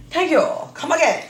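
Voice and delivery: put-on high, loud Chinese accent. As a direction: play the shopkeeper in a Chinese accent. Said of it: "if I do a Chinese accent".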